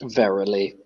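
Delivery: softly deadpan single word; dry, understated deadpan. Acted. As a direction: drop it in without a flicker of expression. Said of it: "He says it exactly that deadpan tone".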